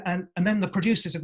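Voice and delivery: ordinary talk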